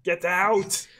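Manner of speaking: high, punchy comic action-star bellow; Arnold impersonation. Acted. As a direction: bark it as a hammed-up action hero. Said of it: "Like my Arnold impersonation".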